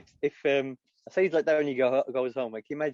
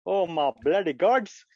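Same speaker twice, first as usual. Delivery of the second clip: put-on slow, higher, louder Indian-accented exclamation; 4 semitones higher, 5 dB louder, slower than usual. Comic hearing Savid Javid got pinged, Indian accent. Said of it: "don't think I do do accents".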